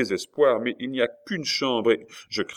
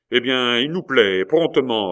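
louder, higher brisk command; impatient order; 5 semitones higher, 6 dB louder than usual. A narrator's reading. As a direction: order it curtly. Coconas orders food impatiently.

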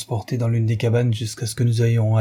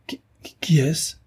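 faint, whispered stammer rising into a question; shocked stammer; 6 semitones higher than usual. A narrator's reading. Stapleton stunned on finding the wrong body, whispered stammer. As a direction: falter on the first word, almost voiceless. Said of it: "balbutia-t-il".

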